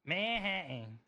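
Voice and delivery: lightly mimicked Southern-style Manhattan; Southern-sounding accent. Applicant echoes the officer's Manhattan, imitated Southern accent. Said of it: "say it with a Southern accent".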